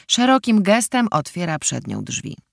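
ordinary delivery

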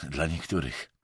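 low, dispassionate jab; impassive. A narrator's reading. Emerson retorts without looking up, impassively. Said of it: "odparł beznamiętnie Emerson".

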